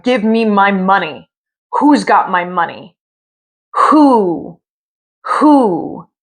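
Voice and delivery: eerie moaning ghost voice rising on the whos; ghostly wail. Acted. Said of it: "And the woman cried".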